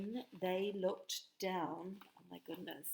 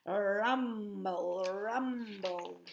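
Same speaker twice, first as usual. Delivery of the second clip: rumbling; much slower than usual. Shown as a demonstration. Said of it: "say that with me".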